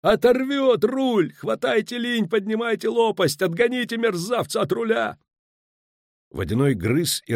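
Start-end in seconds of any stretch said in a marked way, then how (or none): 0.0-5.2 high, frantic shouted orders; sustained yelling far above normal pitch; straining yell. A narrator's reading. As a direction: holler desperate commands to the crew. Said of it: "надрывался Пудлорок".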